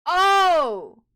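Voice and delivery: loud high shocked yelp; shocked shout. Real, not acted. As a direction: blurt it out in shock. Shocked outburst at reveal.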